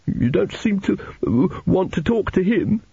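put-on high, halting child's voice; timid tearful boy. A narrator's reading. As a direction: venture it shyly, pausing mid-sentence. Narrator voices timid Arthur.

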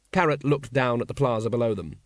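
ordinary delivery

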